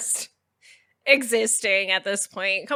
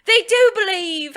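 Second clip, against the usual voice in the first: higher British alien voice; British accent; 9 semitones higher than usual. Acted. Joking to co-host as British aliens, British accent. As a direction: put on a high British accent. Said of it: "I don't know why they're British now".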